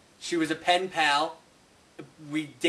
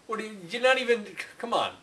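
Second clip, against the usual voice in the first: normal talking voice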